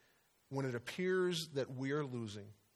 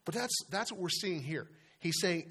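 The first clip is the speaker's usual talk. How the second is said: normal talking voice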